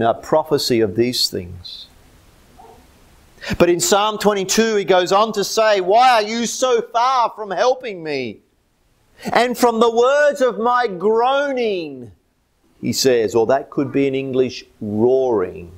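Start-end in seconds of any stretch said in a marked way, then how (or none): 3.6-12.1 high, ringing scripture recitation; impassioned preaching. Real, not acted. Preacher quoting the psalm's plea to God, impassioned. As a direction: proclaim the psalm, stretching the last words.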